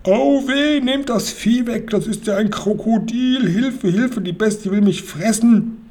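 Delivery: high, wailing cry for help; panicked robber's high-pitched howl; screaming bloody murder. A narrator's reading. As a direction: yell in panic as if about to be eaten. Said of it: "schrie der Räuber Hotzenplotz, Zeter und Mordio".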